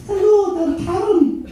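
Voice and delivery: high, loud mock Norwegian with a Scottish lilt; Karen. Friend rings up in Norwegian, loud high Scottish-tinged accent. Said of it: "She's even better when she speaks Norwegian".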